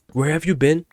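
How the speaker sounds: high-pitched teacher's demand. Retold or imitated. Voices teacher confronting him.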